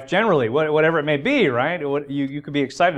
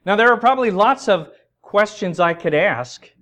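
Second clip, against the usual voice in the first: higher, louder, driving preaching; 8 semitones higher, 7 dB louder than usual. Acted. Preacher urging the congregation, at full preaching force. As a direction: press the point home to the church. Builds to a point.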